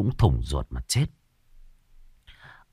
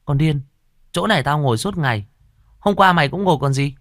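quick, higher scolding; 5 semitones higher, faster than usual. A narrator's reading. Older sister scolds the scared younger one, fast mild scolding. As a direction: snap at the little sister, fast and impatient. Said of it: "Chị tôi đứng ở một bên chửi".

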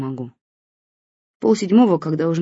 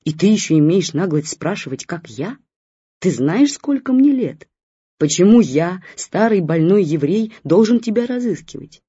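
put-on grumpy old man; 3 semitones higher, 4 dB louder than usual. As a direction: scold her down the line like a hurt old friend. Old man scolds on phone.

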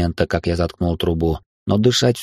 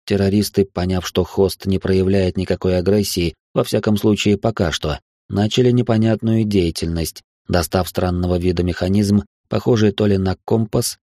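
ordinary delivery, nothing marked

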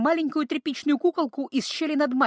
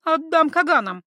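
threatening; 6 semitones higher, 6 dB louder than usual. A narrator's reading. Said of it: "пригрозила девушка".

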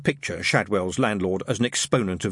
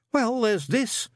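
put-on much higher mild young voice; a meek, polite recruit; timid young private; 12 semitones higher, much slower than usual. A narrator's reading. Newt asking his sergeant, meek and higher.

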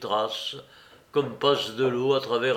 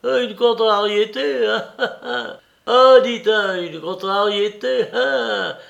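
put-on high, pestering old man repeating hein; a raised, insistent old clerk's whine; nagging litany; 8 semitones higher, 6 dB louder than usual. A narrator's reading. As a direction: needle him over and over in a high voice. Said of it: "le lardait tout vif d'une obsession de litanie".

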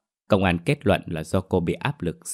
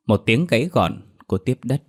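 usual speaking voice

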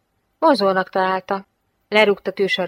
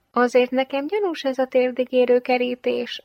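higher, musing suspicion; 6 semitones higher than usual. Acted. Girl wonders about the knee-high fence, musing. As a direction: muse aloud about something fishy. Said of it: "merengett talpig Jane".

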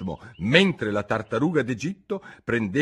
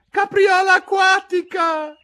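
very high, loud, stretched cry; cried out; 8 dB louder, slower than usual. A narrator's reading. Turtle cries the next figure while pirouetting, high loud shout. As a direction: shout it at the top of the range. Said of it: "gridò la tartaruga d'Egitto".

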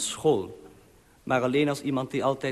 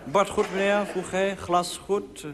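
higher, eager servile waiter voice; bow and scrape as the waiter; hammed-up obliging waiter; fawning waiter; 6 semitones higher than usual. Fawning waiter serving rich guest.

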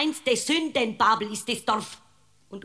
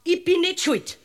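high, loud insistence; accused woman protesting to the judge; indignant protest; 7 semitones higher, 5 dB louder than usual. Acted. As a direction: fling back the accusation. Defendant protests innocence.

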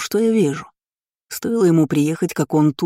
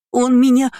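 put-on high, loud childish whine; sobbing for pity; 9 semitones higher, 6 dB louder than usual. A narrator's reading. Boy complains to parents for pity, sobbing. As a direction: whimper it to win sympathy. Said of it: "всхлипывал Лайер, давя на жалость".

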